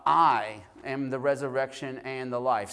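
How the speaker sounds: "I" hit loud and held, the rest easing off; stress on I. Shown as a demonstration. Preacher showing how to emphasize different words of a text, stress on "I". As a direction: lean hard on the word "I". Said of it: "you can emphasize different words".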